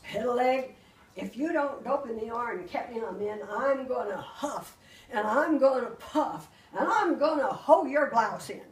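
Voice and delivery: loud wolf threat, breaking into laughter; the wolf's huff and puff threat, half laughing; threatening wolf. Acted. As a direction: growl out the threat while cracking up.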